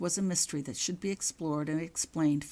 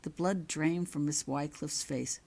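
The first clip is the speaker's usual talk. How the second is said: ordinary delivery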